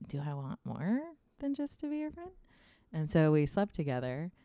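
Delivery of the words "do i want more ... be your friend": wondering younger self. Retold or imitated. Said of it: "I was like".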